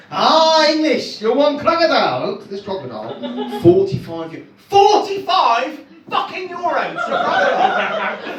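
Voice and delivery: put-on a lightly done generic European accent, salesman pitch; hint of a vague European shopkeeper accent, selling hard. Camp shopkeeper selling the crocodile to the English tourist, light generic European accent. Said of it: "it was a generic European accent".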